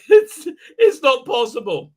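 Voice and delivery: loud, high words through laughter; laughing. Real, not acted. Streamer mocking failed hype, helplessly laughing. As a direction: crack up while trying to speak. Cracks up while talking.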